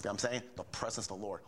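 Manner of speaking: ordinary delivery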